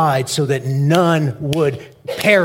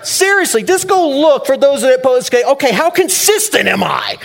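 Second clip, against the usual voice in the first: higher and louder, laughing and fired up; 11 semitones higher, 6 dB louder than usual. Real, not acted. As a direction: pastor heats up about posts, real excitement. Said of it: "I get fired up about this stuff".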